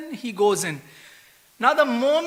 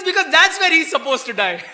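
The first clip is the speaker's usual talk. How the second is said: laughing punchline; 7 semitones higher, 5 dB louder than usual. Retold or imitated. Punchline delivered while laughing.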